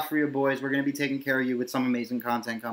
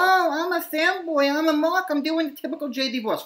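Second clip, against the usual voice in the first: mocking fanboy voice; 12 semitones higher than usual. Podcaster mocks a YouTuber's fake fanboy voice. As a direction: sneer like a caricature of the fan. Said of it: "Because he's like".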